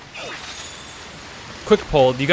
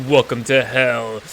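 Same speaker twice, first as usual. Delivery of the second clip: slow villain's welcome, put-on voice; Lucifer; 3 semitones lower, slower than usual. Lucifer greeting magicians in hell, slow mock-menacing voice. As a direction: draw it out with theatrical menace. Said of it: "And Lucifer laughs".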